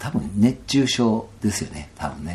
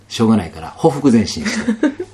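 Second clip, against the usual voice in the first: usual speaking voice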